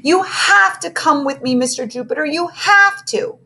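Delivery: put-on high, pleading girl voice; begging. A narrator's reading. Missy begging Mister Jupiter to come with her. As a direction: beg him desperately, rising on the last plea. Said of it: "Now Missy begged".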